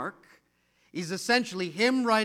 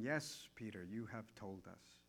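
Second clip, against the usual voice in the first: gentle quiet reply; 9 semitones lower, 16 dB quieter than usual. Pretend. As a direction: answer him tenderly, almost under breath. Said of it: "picture Mark going".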